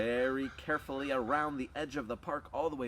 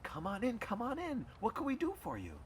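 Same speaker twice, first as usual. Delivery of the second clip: lightly voiced queen, higher; a higher, hospitable queen's welcome; welcoming queen; 10 semitones higher than usual. Queen inviting the dogs into her house, welcoming.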